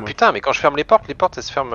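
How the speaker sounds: loud exasperated rant; frustrated. Real, not acted. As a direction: complain loudly at the game. Doors closing wrong, frustrated.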